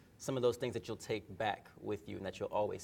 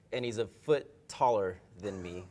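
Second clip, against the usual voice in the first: ordinary talk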